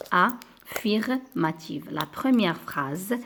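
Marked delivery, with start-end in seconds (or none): 0.1-1.9 affirmative, lent et posé, chaque syllabe nette; lentement syllabé. Shown as a demonstration. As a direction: dire « affirmative » lentement pour que la classe retienne. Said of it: "Une phrase". (« affirmative » articulé lentement, syllabe par syllabe)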